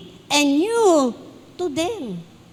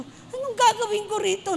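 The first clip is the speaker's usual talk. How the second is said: lightly raised, exasperated question; a hint of helpless dismay; retold dismay; 6 semitones higher, 4 dB quieter than usual. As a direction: ask your child what to do with this. Retold asking child, wrong shoe size.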